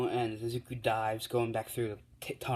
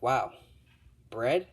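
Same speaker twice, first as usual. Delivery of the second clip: mock amazement; 2 semitones higher, 6 dB louder than usual. All Might gets a huge bread.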